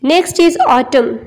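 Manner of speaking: ordinary delivery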